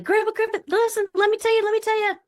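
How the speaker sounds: put-on excited little kid. The excited kid part begging Grandpa to listen, high and eager. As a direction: bounce in like a kid bursting to tell. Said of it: "a fun, curious, little excited part".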